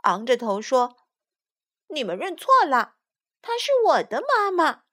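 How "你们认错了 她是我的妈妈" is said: put-on high, insistent child voice; high little objection; little turtle. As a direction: protest like a small turtle claiming mum. Little turtle protests from mother's back.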